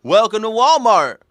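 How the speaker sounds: hammed-up greeting to arriving shoppers; old Walmart greeter. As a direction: call it out like an eager store greeter. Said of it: "stand in front of a Walmart".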